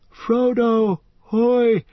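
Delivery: high loud call heard from far off; faint far-off hail; thin distant voices. Acted. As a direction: call from somewhere far ahead in the mist. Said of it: "came the thin voices out of the mist".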